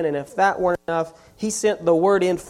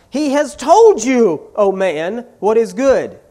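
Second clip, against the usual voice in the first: shout; 3 semitones higher, 6 dB louder than usual. Acted. Micah standing up in court, shouting at his family. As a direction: call it out to the whole court. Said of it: "and he shouts".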